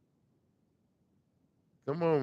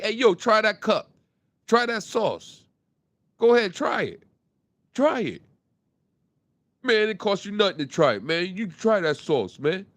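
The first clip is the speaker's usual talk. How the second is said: louder, higher, pushing the sauce on passersby; loud pushy pops; 4 semitones higher, 5 dB louder than usual. Retold or imitated. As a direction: pops calls to people walking by, loud and pushy. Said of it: "my pops be like".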